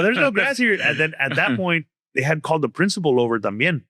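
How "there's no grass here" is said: cheeky comeback. Retold or imitated. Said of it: "Then I go".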